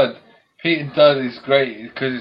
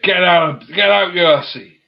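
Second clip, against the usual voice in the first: loud mock yell of outrage; mock shout; 8 semitones higher, 8 dB louder than usual. Pete retelling how he threw out the woman, mock shouting. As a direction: throw her out with a hammed-up yell.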